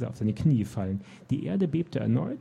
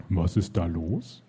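low, slow, wary giant voice; suspicious giant; 6 semitones lower, slower than usual. Acted. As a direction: ask it slowly, like a giant sensing a trick. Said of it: "fragte er misstrauisch".

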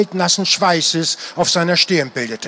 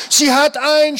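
high-pitched, upset cry; 9 semitones higher, 3 dB louder than usual. A narrator's reading. Ellie sees the harpy seize its prey, crying out. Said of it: "schrie Ellie".

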